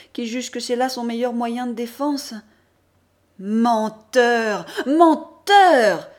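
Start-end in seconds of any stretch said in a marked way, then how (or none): 3.4-4.7 loud, angry cry of Menteur!; accusing shout. Acted. Mother accusing her son: angry loud Menteur!. 4.9-6.1 Menteur! again, far louder and higher, at full force; higher, shriller repeat. Acted. Mother accusing her son: screamed, higher Menteur!.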